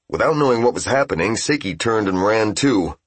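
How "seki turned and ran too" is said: ordinary delivery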